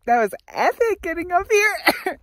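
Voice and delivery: triumphant at the top; excited. Real, not acted. Reached the summit, excited.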